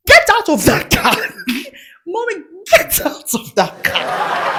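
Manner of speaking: mom's stern order. Retold or imitated. Mom ordering her daughter out of the car in a stern voice.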